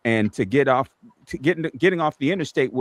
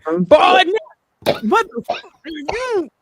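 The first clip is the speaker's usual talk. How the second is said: high shrieking mock panic from the passenger seat; imitating Joy; 5 dB louder, much slower than usual. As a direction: squeal it like a terrified passenger. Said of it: "and Joy going".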